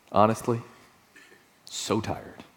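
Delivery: low and very soft, worn out; exhausted, voice sinking away; weary admission. Acted. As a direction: drop to a tired, hushed confession. Admitting tiredness to the congregation.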